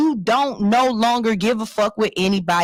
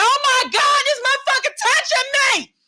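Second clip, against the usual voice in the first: retold scream. Pregnant woman shrieking at stranger reaching for her belly. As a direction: shriek it out in shock. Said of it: "And I screamed".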